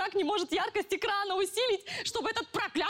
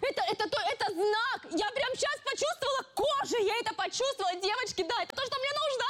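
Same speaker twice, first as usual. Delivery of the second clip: excited; 5 semitones higher than usual. Acted. Sudden inspired excitement.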